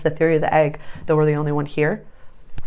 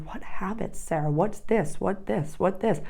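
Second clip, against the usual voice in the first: mock bewildered follower; 7 dB quieter than usual. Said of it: "you're like".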